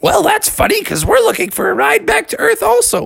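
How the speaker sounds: put-on high-pitched kid voice. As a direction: chirp it as a gang of kids. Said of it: "all the kids were like".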